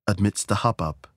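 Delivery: usual speaking voice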